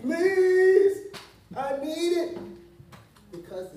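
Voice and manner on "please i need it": high, pleading cry, acted out; imitated tearful begging; bawling plea. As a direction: beg in a much higher voice as if bawling. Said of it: "I'm just bawling".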